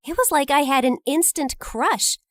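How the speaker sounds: ordinary delivery, nothing marked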